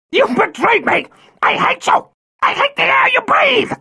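very high screaming fury; crying with rage. Acted. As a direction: shriek pure hatred. Violet's final hateful scream.

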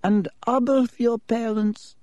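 put-on old headmaster voice. A narrator's reading. Headmaster Dippet speaks.

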